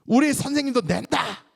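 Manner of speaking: high, loud angry comeback; flared-up retort. Acted. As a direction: act out wounded pride blurting back. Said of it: "확, 확 난 거예요".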